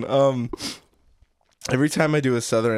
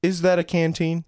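Southern father, pointedly questioning; Southern accent; 4 semitones higher than usual. Acted. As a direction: press the boy in a Southern accent. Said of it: "Southern accents?".

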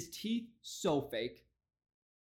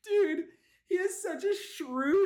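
shocked; 14 semitones higher, 4 dB louder than usual. Real, not acted. Shocked reaction to politician's face.

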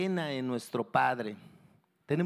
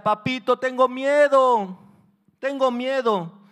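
high, loud, drawn-out cry; frightened pleading yell; terrified child; 10 semitones higher, 10 dB louder, slower than usual. Acted. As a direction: wail to daddy in fear. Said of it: "pero el temor era demasiado".

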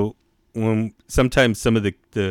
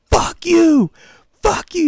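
put-on very high, loud yelling kid; acted-out furious squeal; imitated yelling; 6 dB louder, slower than usual. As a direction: screech like a kid in a tantrum. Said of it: "He's fucking yelling at me".

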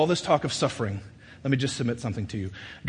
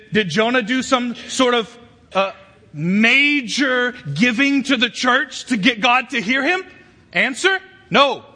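raised, impassioned preacher's voice; full-force preaching; 8 semitones higher, 6 dB louder than usual. Acted. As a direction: drive each phrase home, high and loud.

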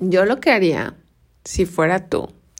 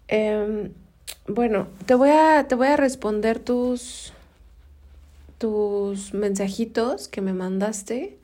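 normal talking voice

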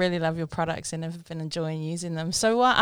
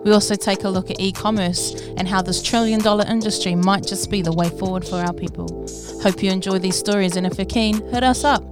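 podcast intro read; 6 dB louder than usual. Acted. Podcast intro read over music.

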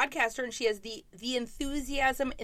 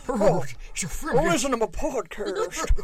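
horsey voice; 5 dB louder than usual. Acted. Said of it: "the worst possible way".

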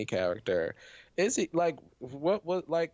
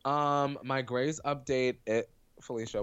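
ordinary talk

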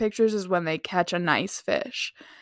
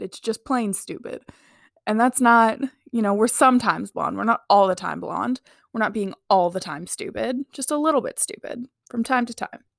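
ordinary delivery

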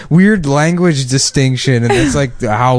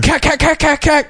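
high mock gun noises; imitated gunfire; 14 semitones higher than usual. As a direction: rattle off fake gunshots.

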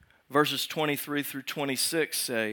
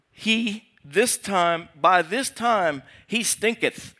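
higher, grandly drawn-out scripture voice; mock King James; 5 semitones higher, 3 dB louder, slower than usual. Preacher quoting Martha on dead Lazarus, mock King James. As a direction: ham up the archaic line for a laugh. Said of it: "when people talk in the King James".

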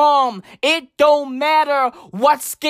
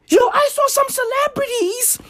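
outraged yelp; 12 semitones higher than usual. Real, not acted. Building outrage at celebrities.

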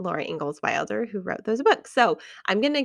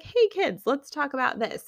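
higher-pitched mock parent chirp; awkward parent opener; 6 semitones higher than usual. Parent broaching an awkward subject with the kids, mock-awkward. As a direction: pitch it up, imitating an awkward talk-starter. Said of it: "would have been awkward to be like".

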